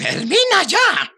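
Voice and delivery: impatient snap. Acted. Jefe impaciente interrumpe.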